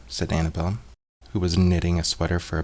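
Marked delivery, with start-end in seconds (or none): none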